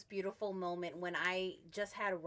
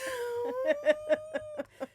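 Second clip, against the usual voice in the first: teary laugh; 8 dB louder than usual. Real, not acted. Laughing at her own tears.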